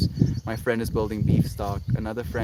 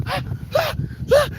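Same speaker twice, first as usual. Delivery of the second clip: high mock gasp. Acted struggle.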